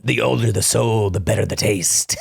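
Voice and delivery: hammed-up villain savoring the words; mock ominous narration. Villain narration ending in evil laugh.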